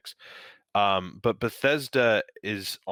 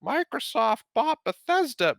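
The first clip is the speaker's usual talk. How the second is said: mock excitement; 7 dB louder than usual. Mocking what everyone said.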